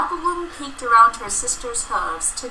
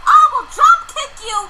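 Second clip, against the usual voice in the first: high loud threatening rant; angry shouting; 8 dB louder than usual. Acted. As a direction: yell a comic threat, laughing. Threatening shout over jam.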